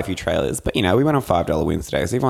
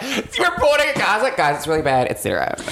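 higher, laughing mock newsreader; hammed-up vague TV reporter; mock clueless reporter; 12 semitones higher than usual. Parodying an uninformed journalist, higher and laughing. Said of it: "I'm like".

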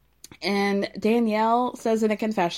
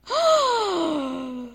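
sharp, high intake of breath; shocked gasp; 7 semitones higher than usual. Real, not acted. Vlogger gasping at Joel snubbing Danielle. Reacting to Joel's tequila remark.

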